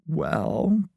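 drawn-out, rising well; slow, hesitant. A narrator's reading. Friend reluctant to confess his lie, slowly. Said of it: "he said slowly".

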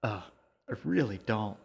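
mild groan of reluctance. A narrator's reading. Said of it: "She groaned".

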